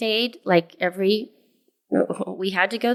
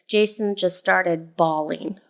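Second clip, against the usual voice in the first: ordinary delivery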